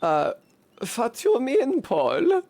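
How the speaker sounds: put-on high-pitched woman with a German accent. A villager questions Paul, German accent. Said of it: "give her an actual German accent".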